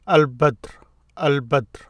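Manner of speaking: Al-Badr with the L sounded, B undoubled; L pronounced. Shown as a demonstration. Teacher showing a moon letter without stress mark, Al-Badr with L sounded. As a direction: say Al-Badr with a clear L. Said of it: "There is no stress mark here".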